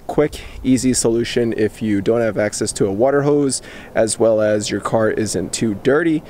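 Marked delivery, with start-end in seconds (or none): none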